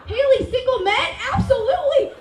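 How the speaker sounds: high, loud and laughing; eager. Retold or imitated. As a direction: jump at the chance. Eager about single men.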